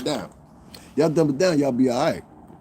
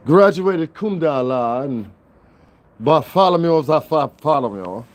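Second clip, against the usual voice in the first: put-on educated talk, raised and drawn out; mock highbrow; 4 semitones higher, 4 dB louder, slower than usual. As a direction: imitate a pompous honors graduate, louder and higher. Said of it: "I can talk like that too".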